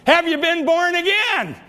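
pitched high and insistent; high voiced old man asking; old man's question. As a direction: call it out from the back pew, high. Old man asks from the back.

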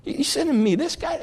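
high, incredulous scoffing; hammed-up outraged giant; mock indignant giant. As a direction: sneer in disbelief at the little boy. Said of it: "he gets mad".

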